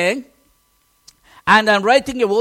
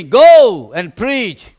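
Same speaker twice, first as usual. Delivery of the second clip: slow, loud divine command; loud, slow and weighty; 11 dB louder, much slower than usual. Retold or imitated. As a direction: give the order like the Spirit speaking. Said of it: "the Spirit came to me".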